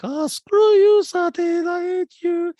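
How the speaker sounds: imitating a disgusted castmate at her plate; high squeaky mock hate. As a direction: squeal it like a cartoon brat.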